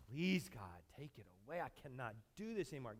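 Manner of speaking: weary plea. Retold or imitated. Paul's worn-out second prayer.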